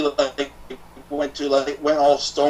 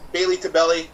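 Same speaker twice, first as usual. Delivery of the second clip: usual speaking voice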